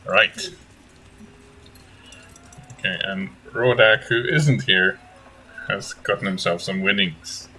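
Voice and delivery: slow storytelling recap; story narration. Acted. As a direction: recap the last session like a storyteller. Game master recap narration.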